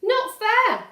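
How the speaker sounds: put-on high loud childish shout. Valdemar protesting to Papa, loud high shout. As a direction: yell it like a stamping child. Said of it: "shouts Valdemar".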